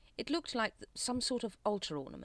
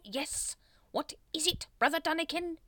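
high, irritated query; exasperated leader voice; impatient; 12 semitones higher, 5 dB louder than usual. A narrator's reading. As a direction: demand to know why you were interrupted. Grand Master interrupted again.

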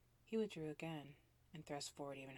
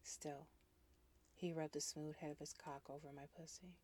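ordinary delivery

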